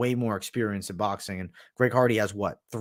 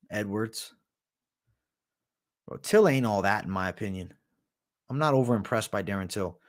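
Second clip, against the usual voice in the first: ordinary talk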